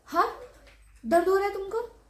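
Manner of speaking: husband jumping up, voice going high and loud; sudden worried rise, you're in pain?; alarmed question. Retold or imitated. Husband hears his wife's pain and jumps up, alarmed, high and loud.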